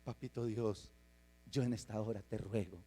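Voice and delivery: soft, tender prayer to Daddy God; a son softly praying to his father; childlike prayer. Retold or imitated. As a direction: pray like a trusting child, hushed. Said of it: "usted dice".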